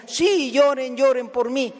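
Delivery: milk the crowd's tears as a joke; mock self-pity. Preacher mocking a self-pitying Jesus, hammed-up plea.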